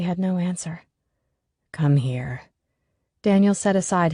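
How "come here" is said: whisper. A narrator's reading. Dan comforting Allie.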